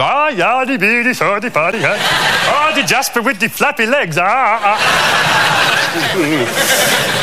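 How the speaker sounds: a big Swede mocking his buddy's legs, in a Swedish accent. The Swedish buddy teases the narrator, hammed-up Swedish accent. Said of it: "this Swedish blonde bloke called Eric".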